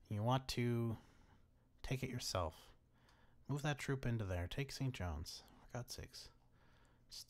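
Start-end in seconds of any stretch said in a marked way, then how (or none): 5.6-7.3 exasperated whisper. Real, not acted. Exasperated at the game.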